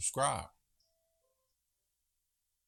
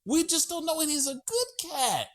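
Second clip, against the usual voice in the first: gushing shelter worker. Retold or imitated. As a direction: coo over the handsome cat like shelter staff. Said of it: "They said".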